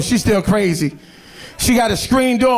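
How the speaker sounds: usual speaking voice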